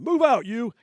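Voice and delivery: high, loud drill sergeant bark; barked order. Retold or imitated. Sergeant barking at the cheeky recruit, high and loud. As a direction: snap the order at him.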